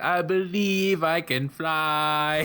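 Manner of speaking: slow, high mock Chinese accent; high-pitched Chinese accent. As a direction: draw it out slowly in a put-on Chinese accent. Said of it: "in a really high-pitched Chinese accent".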